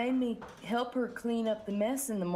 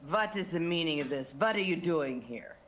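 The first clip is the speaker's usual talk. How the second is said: low, displeased boss voice; stern accented boss; 4 semitones lower than usual. Acted. Boss turning the men away, low stern refusal. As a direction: refuse them service, low and firm.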